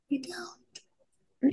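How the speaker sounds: whispered repeat. Real, not acted. Agreeing with co-host about the missing title song, whispered.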